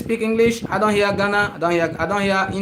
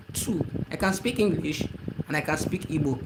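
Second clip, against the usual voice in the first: ordinary talk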